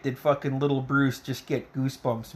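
ordinary delivery

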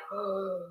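soft mock gasp; quiet intake of breath in imitated shock. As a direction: gasp like astonished kids. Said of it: "they're all like".

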